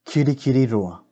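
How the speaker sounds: "Kiri, kiri, roa" run together as one word; whole word in one smooth run; all together. Shown as a demonstration. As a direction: join the chunks without pauses. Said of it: "Say it all together".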